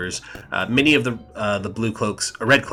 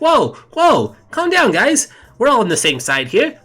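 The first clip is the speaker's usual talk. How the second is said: much higher, louder placating critter voice; Emerald calming everyone; 13 semitones higher, 5 dB louder than usual. Acted. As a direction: hands up, talk them down. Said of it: "he's just like".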